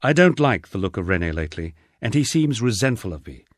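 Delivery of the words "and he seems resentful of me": usual speaking voice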